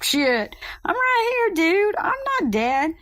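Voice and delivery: high, outraged protest; indignant disbelief. Retold or imitated. Mother to coach who thinks she died, outraged high protest. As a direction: retell the shock of being called dead.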